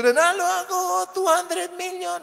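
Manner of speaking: loud, high shout of victory; shouting winner. Pretend. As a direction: yell the lotto win for everyone to hear. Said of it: "Huwag kang sumigaw".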